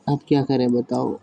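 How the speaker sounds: normal talking voice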